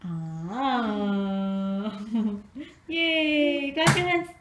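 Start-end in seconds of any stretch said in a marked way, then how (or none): 2.9-4.3 high, loud cheering. Real, not acted. Cheering baby on.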